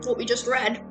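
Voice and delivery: normal talking voice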